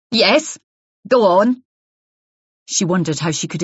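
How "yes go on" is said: challenging prompt. Acted. Wife pressing Isabel sarcastically.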